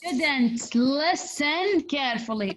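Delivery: "listen" slowly drawn out and emphasised; stress on listen. Shown as a demonstration. Teacher showing students how to highlight key information, stress on "listen". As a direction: lean on "listen", stretching it well out. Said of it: "شوفوا كيف أنا جالسة أنطق".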